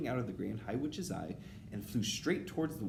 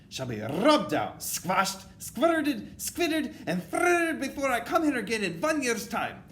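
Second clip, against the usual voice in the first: loud witch tirade climbing high; raging witch commander; shrieked orders; 8 semitones higher, 10 dB louder than usual. A narrator's reading. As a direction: issue orders, punching each gruesome word louder. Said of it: "shrieked the Grand High Witch".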